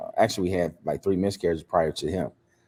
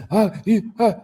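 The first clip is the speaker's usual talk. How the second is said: mocking son's overtalk; 12 semitones higher, 3 dB louder than usual. Son overtalking father, mocked.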